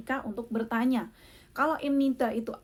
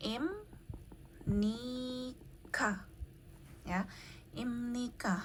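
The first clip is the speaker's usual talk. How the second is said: Imnika with a sharp rising tune; soft rising question; question rise; 9 dB quieter than usual. Shown as a demonstration. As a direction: lift the end of Imnika like a question. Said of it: "Itu tanda tanya ya pasti".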